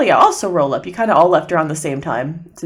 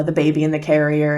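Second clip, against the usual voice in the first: ordinary delivery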